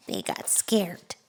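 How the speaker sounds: giggly hushed whisper; soft laughing whisper. Acted. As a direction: whisper it like a secret.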